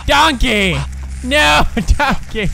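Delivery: high, loud panicked cry; scared shout. Real, not acted. Gamer spotting the monster, panicked shout. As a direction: yell the name in real fright.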